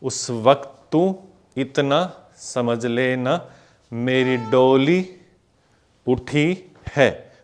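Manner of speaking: slow, drawn-out sung-speech lyric; slow lyric recital. Shown as a demonstration. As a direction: stretch each word to the beat. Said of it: "फिर से देखो".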